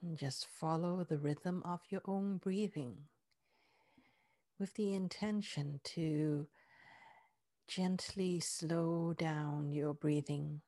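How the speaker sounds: soothing massage murmur. Acted. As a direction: lull the body loose, gently. Relaxation part of meditation.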